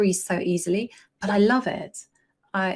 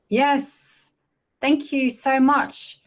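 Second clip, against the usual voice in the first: higher, louder and slow, beaming thanks; excited thanks; 7 semitones higher, 6 dB louder, much slower than usual. Real, not acted. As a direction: thrill at the tip, stretch out the thanks. Thanking chat for suggestion.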